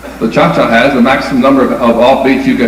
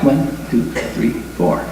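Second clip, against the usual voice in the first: light unaccented tango beats; very light counting; 8 dB quieter than usual. Shown as a demonstration. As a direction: count gently, no accents at all. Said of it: "They're all very light".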